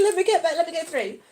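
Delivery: ordinary talk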